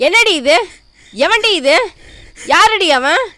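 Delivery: loud, sharp accusing questions; furious parent demanding answers; angry interrogation. Acted. Mother confronts daughter over boy.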